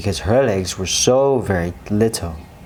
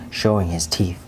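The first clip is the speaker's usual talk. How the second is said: usual speaking voice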